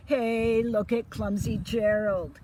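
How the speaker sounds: loud jeering taunt; mock him across the dance floor; sneering. Animals jeering at Gerald, loud sneer. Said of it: "the animals all sneered".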